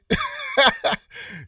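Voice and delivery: high-pitched burst of laughter. Real, not acted. Host joking about Memphis accents, high cackling laugh.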